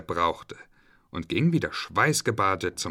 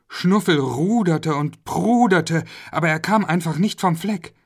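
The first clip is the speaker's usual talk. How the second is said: excited narration; 8 semitones higher, 6 dB louder than usual. A narrator's reading.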